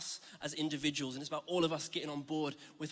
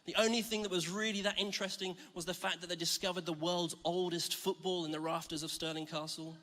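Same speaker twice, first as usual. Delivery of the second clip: ordinary talk, nothing marked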